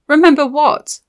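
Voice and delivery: high, loud frantic question; shouting. Acted. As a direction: call after her, frantic. Layla shouts at the fading witch.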